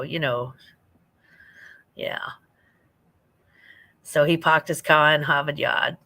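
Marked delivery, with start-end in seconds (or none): 4.0-6.0 put-on playful Boston accent, dropping the R's; put-on Boston R-less vowels. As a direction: tease with a New England non-rhotic accent. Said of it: "New Englander".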